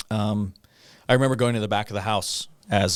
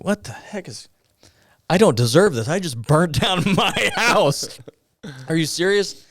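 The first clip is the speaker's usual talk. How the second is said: high, loud, stretched-out astonishment with a laugh; stunned gratitude, laughing in disbelief; overwhelmed disbelief; 9 semitones higher, 5 dB louder than usual. Retold or imitated. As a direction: blurt it out, floored by their kindness. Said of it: "And I'm like".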